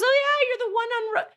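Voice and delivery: high, loud burst of delighted recognition; excited recognition. Retold or imitated. Delivery guy recognizing a regular customer, excited. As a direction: exclaim it high as the penny drops.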